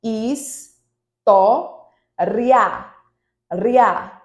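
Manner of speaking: "is, to, ria" split into separate syllables with pauses between. Shown as a demonstration. Teacher showing students the split of história, syllable by syllable. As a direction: sound out "is", "to", "ria" one at a time like spelling on the board. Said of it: "você separa da seguinte forma".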